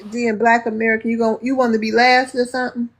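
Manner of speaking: ordinary talk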